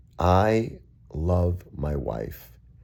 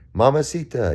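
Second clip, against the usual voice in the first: higher, louder flirty call of Mamacita; imitating his friend Joey; 10 semitones higher, 6 dB louder than usual.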